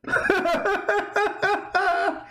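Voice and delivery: a high, scornful burst of laughter; high mocking cackle. Real, not acted. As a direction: laugh him off, high and derisive.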